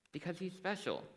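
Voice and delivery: high-pitched, imitated mother's awkward answer; hesitant mother. Mother responding to her child in the grocery line, hesitantly. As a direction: pitch it up like a parent delicately explaining. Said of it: "I can hear the hesitation in her voice".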